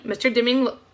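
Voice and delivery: usual speaking voice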